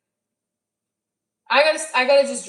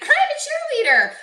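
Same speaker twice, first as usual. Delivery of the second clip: very high, loud, bubbly cheerleader squeal; perky cheerleader; 5 dB louder than usual. Retold or imitated. Imitating the bartender's energy, shrieky cheerleader pep. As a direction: burst out with mock pep. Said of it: "she didn't come in with that energy".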